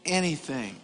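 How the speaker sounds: "anything" said low and soft; understated. Shown as a demonstration. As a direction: drop "anything" down quietly. Repeats key word, first take.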